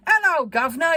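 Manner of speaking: high, loud mock Cockney greeting; thick Cockney accent. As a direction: call out in a put-on Cockney accent. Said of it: "Cockney type of accent".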